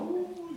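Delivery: high mock monkey-like "Ooh" howl; playful monkey howl imitation; imitated howl. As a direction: mimic the howling passengers. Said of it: "they're literally howling, look like howler monkeys".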